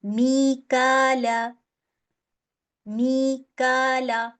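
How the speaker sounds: मीकाला very slowly, long ई and long final आ held two counts; slow drawn-out मीकाला; Quran recitation. Shown as a demonstration. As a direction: stretch मीकाला in recitation style. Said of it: "तो मैंने कैसे इसको पढ़ना है?".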